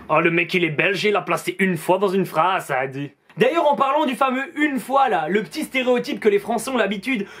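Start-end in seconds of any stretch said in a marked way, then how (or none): 0.1-3.1 mocking Frenchman. A French viewer mocks the Belgian host, lower mocking voice. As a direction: lower put-on voice of a smug Frenchman.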